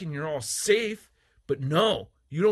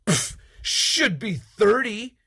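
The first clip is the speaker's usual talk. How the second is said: slow, higher, louder scoff; mock scoffing lowball; 7 semitones higher, 9 dB louder, slower than usual. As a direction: sneer out a lowball offer. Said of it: "But you don't say".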